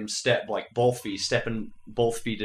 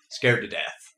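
ordinary talk

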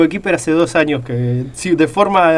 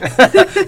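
high laugh; 12 semitones higher than usual. Real, not acted. Host laughs at the joke.

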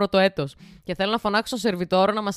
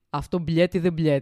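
mock Russian; 3 semitones lower, slower than usual. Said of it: "Λέμε, «Μαν".

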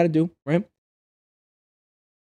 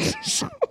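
high laugh; 10 dB quieter than usual. Real, not acted. Laughing at own story.

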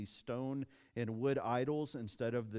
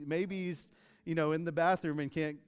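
higher, playfully taunting tone; Elijah's mocking excuse; 4 semitones higher, 4 dB louder than usual. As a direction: make a teasing excuse for the absent god. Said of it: "Elijah has some fun with it".

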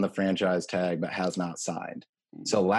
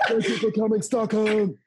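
DJ announcement; 12 semitones higher than usual. Pretend. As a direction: shout it to a stadium crowd. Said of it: "Swedish House Mafia".

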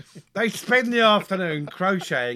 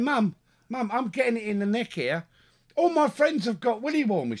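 mock whining to mum; 7 semitones higher than usual. Complaining to mum, retold.

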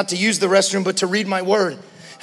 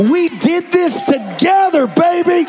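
put-on higher and louder preaching, building to a shout on "together, baby"; building sermon climax; 5 semitones higher, 3 dB louder than usual. Pastor rallying the church, rising to a full shout. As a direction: preach it up to the peak.

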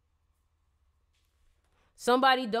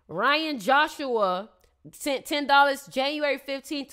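ordinary talk, nothing marked

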